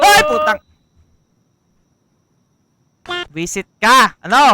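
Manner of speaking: shrieking very high and loud; startled scream. Real, not acted. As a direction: scream from a sudden fright. Jump scare by vendor shout.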